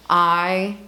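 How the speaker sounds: sincere apology. Real, not acted. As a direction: lay each word down gently. Apology to hurt listeners.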